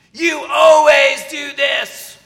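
mock angry shout; angry accusation. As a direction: yell it at your spouse in a fight. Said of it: "You ever heard people talk like that?".

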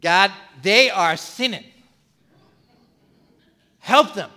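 a lightly hammed-up pious plea; mock self-righteous prayer. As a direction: pray about them, not about yourself. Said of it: "A lot of times that's our prayer".

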